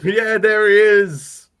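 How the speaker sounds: high, loud, slow delighted shout; excited cheer. Real, not acted. Actor appears on screen.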